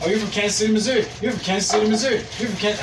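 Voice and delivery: high, repetitive mock questioning; mock spamming. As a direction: rattle off the questions like spammed texts. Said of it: "I just spam texted her that shit".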